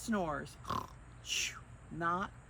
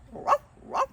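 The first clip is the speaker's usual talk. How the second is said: high, louder playful woof; dog barking; 11 dB louder than usual. Pretend. Reading to children, barks the dog's name. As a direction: bark it out like a little dog.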